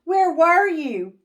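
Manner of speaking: high, loud eager question; eager kids. Acted. Kids pressing their teacher, high and eager. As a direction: ask it eagerly. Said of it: "cried all the kids".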